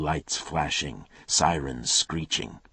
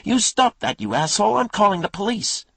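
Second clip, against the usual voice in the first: very high, loud furious cry from a window; shrill angry woman; 9 dB louder than usual. Acted. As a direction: yell down shrilly. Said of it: "shrill, as angry as Coop was".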